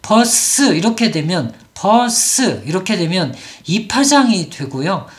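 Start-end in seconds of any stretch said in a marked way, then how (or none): 0.0-0.7 "버스" split as 버-쓰; two syllables. Shown as a demonstration. Teacher demonstrating what goes wrong, "버스" in two syllables. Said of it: "이음절로 소리를 내고". 1.7-2.6 "버스" drawn into 버어-쓰; two syllables. Shown as a demonstration. Teacher demonstrating what goes wrong, "버스" in two syllables. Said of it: "이음절로 소리를 내고".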